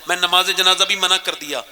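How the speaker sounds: ordinary delivery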